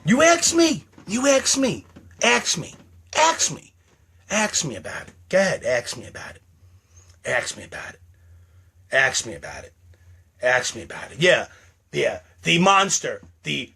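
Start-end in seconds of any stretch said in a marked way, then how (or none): 0.0-3.7 high, insistent dare; taunting challenge. Acted. Daring the viewers to ask. 4.3-11.2 low, quiet, repeated dare; menacing under the breath; brooding dare. Acted.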